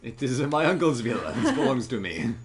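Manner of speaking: put-on laughing through an Italian accent; a hammy Italian accent, amused; Ezio's Italian accent. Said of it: "I'm doing an Ezio impression".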